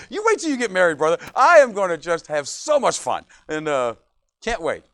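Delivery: ordinary talk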